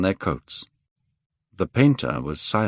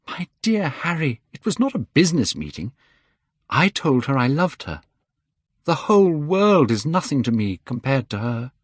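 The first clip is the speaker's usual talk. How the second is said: put-on higher, eager young man protesting; young Dorian, fervent; 6 semitones higher than usual. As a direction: brush off Harry's cynicism with passion. Dorian protests his love.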